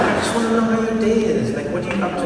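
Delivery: high, giggling fake innocence; laughing innocence. Son laughing as he dodges mom's question. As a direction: laugh through the cover story.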